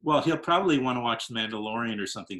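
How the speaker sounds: ordinary talk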